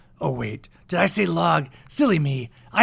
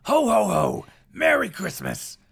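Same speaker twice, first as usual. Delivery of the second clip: Santa voice; 7 semitones higher than usual. Acted. Santa greeting a child.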